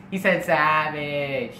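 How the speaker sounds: "savage" stretched out very slowly; emphatic correction. Retold or imitated. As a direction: spell out "savage" slowly for someone who misheard. Acted son correcting dad's mishearing.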